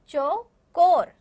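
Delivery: चौकोर slow and loud, split after चौ; teacher breaking चौकोर apart for learners; syllable by syllable. Shown as a demonstration. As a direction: say चौ, pause, then a long कोर. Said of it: "आप मेरे पीछे बोलेंगे".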